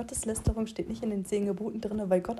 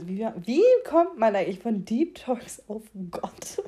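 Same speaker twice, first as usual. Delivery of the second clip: ordinary delivery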